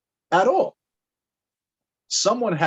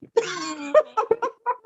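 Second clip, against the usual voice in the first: giddy high laugh. Real, not acted.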